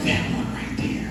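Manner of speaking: put-on little boy whispering to his mom. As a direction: hush it like a kid telling his mom.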